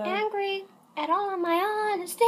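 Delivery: bleating like a goat on angry at all of my honesty. Acted. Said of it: "That was a bit of a goat voice".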